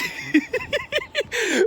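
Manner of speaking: high burst of laughter. Real, not acted. Laughing at his own story.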